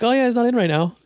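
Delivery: a hint of a rote, robotic staffer. Staff covering for the boss, mock robotic. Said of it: "my staff is, like, robotic".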